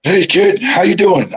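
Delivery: high-pitched greeting in a colleague impression; John Laurinaitis. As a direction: hail the kid in a put-on high voice. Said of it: "Johnny's sitting there".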